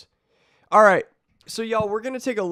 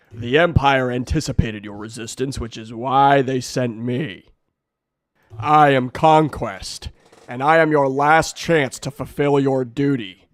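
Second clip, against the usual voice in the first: loud, commanding alien warlord; menacing conqueror; 3 semitones lower, 5 dB louder than usual. Acted. Conquest scolding the hero, loud menace. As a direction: boom down at a lesser being.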